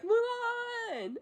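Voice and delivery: mock holler held back in volume; imitated Mushu calling. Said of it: "And Mushu's like".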